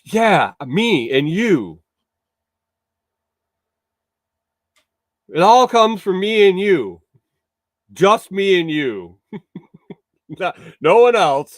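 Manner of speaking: drawn-out, loud, ironic emphasis; mock emphatic. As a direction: lean hard on it, joking. Mock answer to chat.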